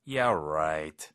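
low, drawn-out sarcasm; skeptical, stretched "right"; sarcastic. Shown as a demonstration. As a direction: drop the pitch and doubt them. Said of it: "약간 비꼬는 톤이죠".

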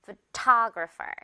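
slow photographer, ta stressed; slow teaching model; slow drill word. Shown as a demonstration. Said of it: "마지막 단어".

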